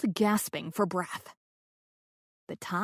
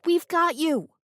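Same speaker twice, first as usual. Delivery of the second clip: urgent reassurance; 8 semitones higher, 5 dB louder than usual. A narrator's reading. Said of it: "Bernie said".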